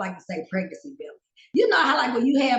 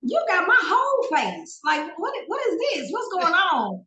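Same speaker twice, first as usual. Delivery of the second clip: shocked disbelief; 10 semitones higher than usual. Retold or imitated. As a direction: squeal in disbelief. Retold shock seeing herself.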